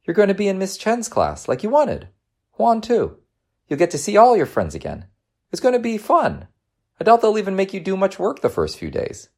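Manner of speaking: high, upbeat mom voice; bright-spirited reassurance, pitched well up; encouraging mother. Acted. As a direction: cheer up a reluctant child.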